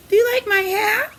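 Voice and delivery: high, quick asking voice; hat dog voice. Acted. Reading to a child, the dog with the hat asks eagerly, high.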